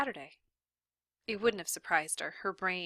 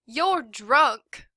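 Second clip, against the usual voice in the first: higher, loud angry yell; teacher yelling at the drunk sheriff; 5 semitones higher, 11 dB louder than usual. A narrator's reading. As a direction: throw it in his face. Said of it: "she yelled".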